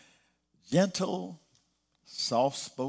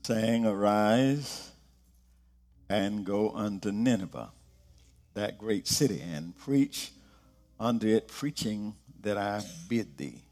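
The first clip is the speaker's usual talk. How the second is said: measured, lower reading of the verses; solemn low scripture reading; 5 semitones lower than usual. Acted. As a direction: read the Bible passage solemnly. Scripture reading opening sermon.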